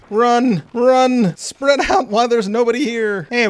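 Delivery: high, loud rallying call; mock-urgent commander's shout; calling out orders. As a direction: urge the troops on, loud and high. Urging his troops onward.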